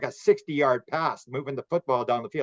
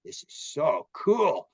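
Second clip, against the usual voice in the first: awed right brain; 4 semitones higher than usual. Retold or imitated. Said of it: "the right brain is saying".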